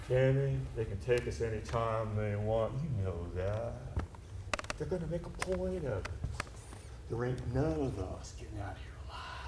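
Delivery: very soft, fading into a whisper; near-whispered fatalism; grim hushed warning. Acted. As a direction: confide the dread under your breath. Comrade's grim confidence at dawn.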